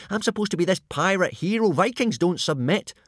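indignant. A narrator's reading. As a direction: insist on your hero's duty. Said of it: "said Hiccup indignantly".

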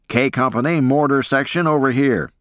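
high-pitched officer's call, moderate volume; shouted order. A narrator's reading. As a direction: call the men over from a distance. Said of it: "yelled and shouted orders".

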